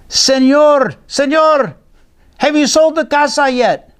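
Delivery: put-on high, eager boy calling the man; Diego calling. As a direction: call out hopefully like a small boy. Diego calls out to the seller.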